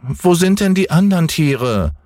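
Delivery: hohe, quengelnde Kinderstimme; kleiner Junge schreit. Acted. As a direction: fordernd wie ein ungeduldiges Kind. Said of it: "rief der kleine Häwelmann hinauf". (hoch und fordernd gerufen)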